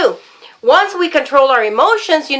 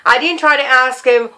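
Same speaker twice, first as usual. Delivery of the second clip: ordinary talk, nothing marked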